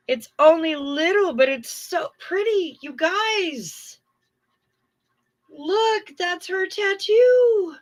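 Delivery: high, gushing coo over a cute photo. Real, not acted. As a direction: coo at it like something adorable. Cooing over a tattoo photo.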